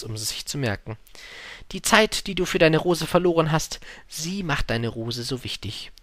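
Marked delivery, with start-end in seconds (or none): 1.7-5.9 wise fox. Acted. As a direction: teach it earnestly, slowing at the end.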